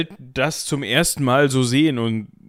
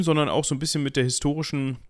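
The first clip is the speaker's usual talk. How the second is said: ordinary talk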